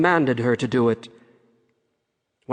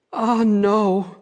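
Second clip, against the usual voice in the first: higher princess voice; frightened young princess; 6 semitones higher than usual. A narrator's reading. Princess answering her father the king, higher and uneasy. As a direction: answer up high as the daughter.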